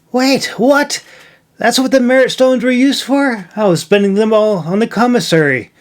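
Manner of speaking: Milo's voice jumps up in shocked disbelief; surprised exclaim. A narrator's reading. Milo realizing he wasted his merit stones, shocked. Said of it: "he exclaimed".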